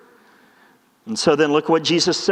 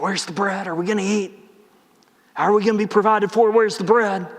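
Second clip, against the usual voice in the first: mock anxious. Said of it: "instead of getting all worried and anxious".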